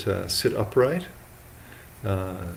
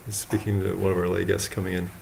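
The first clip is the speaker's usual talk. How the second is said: usual speaking voice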